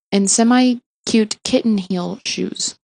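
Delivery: plain reading voice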